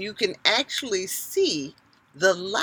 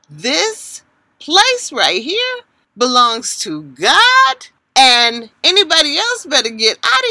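commanding prayer; 5 semitones higher, 7 dB louder than usual. Retold or imitated. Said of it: "I just start saying".

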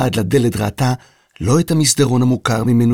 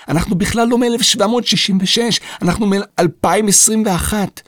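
put-on a girl's higher voice, earnest and pressing; insistent girl; 10 semitones higher than usual. A narrator's reading. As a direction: convince him you're from the future. (ילדה נחושה בקול גבוה)

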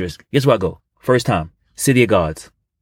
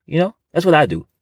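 normal talking voice

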